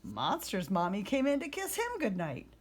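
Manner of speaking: usual speaking voice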